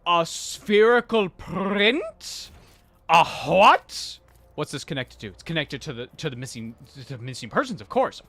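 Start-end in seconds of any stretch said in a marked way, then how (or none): 0.1-4.2 slow, high, loud disbelief; baffled. Real, not acted. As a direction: drag out the baffled question. Baffled reaction to clue.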